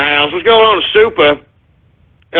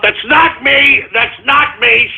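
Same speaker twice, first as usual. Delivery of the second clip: high, exasperated protest; angry denial; 4 semitones higher than usual. Real, not acted. Host denying a listener's jibe, angry high protest.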